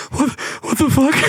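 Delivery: high, panicky disbelief; panicked yelp. Retold or imitated. Host imitating the panicking girl, high and frantic. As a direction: squeal it in panic. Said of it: "she's like".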